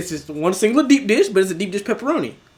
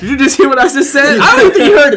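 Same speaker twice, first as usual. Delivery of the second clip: louder high incredulous laugh-talk; laughing loud disbelief; 8 semitones higher, 12 dB louder than usual. Retold or imitated. Worker reacting to the customer's order, loud laughing disbelief. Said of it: "I'm like".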